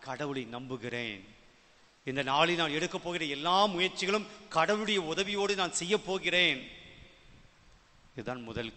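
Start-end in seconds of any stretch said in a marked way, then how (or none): 2.1-6.6 louder, higher preaching voice; fervent prayer declaration. Acted. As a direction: declare dependence on God with full force. Preacher declares a morning prayer of faith. (உரத்த குரலில் விசுவாச அறிக்கை)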